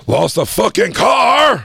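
high, drawn-out yell; big incredulous holler; mock outraged shout. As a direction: shout it in exaggerated disbelief. Mock outburst over lost car.

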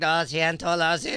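put-on hurried apology with a Spanish accent; Spanish guy. Scooter rider apologizing to a screaming woman, Spanish accent. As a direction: imitate an apologetic Spanish speaker. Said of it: "some Spanish guy on a scooter".

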